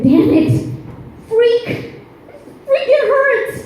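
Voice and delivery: high-pitched substitute cursing; mock pained outburst. Comic stubbing toe on swearing ban, hammed-up pained yelps. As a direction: yelp substitute swear words in hammed-up pain.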